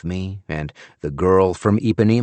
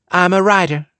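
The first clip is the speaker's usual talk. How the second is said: put-on shrugging Southern accent; 11 semitones higher, 5 dB louder than usual. A narrator's reading. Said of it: "with a shrug".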